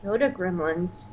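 very soft, low murmur; quiet echo. Real, not acted. Co-host puzzling over the name, quiet echo. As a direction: repeat it under your breath, pondering. Puzzled repeat of co-host's phrase.

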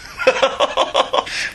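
short hearty laugh. Real, not acted. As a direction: burst out laughing. Opening laugh among hosts.

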